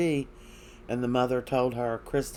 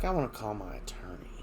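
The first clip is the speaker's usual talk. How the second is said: whispered demand; 3 semitones lower, 7 dB quieter, faster than usual. Retold or imitated. Jailed Kristen demands lawyer.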